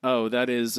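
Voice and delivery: normal talking voice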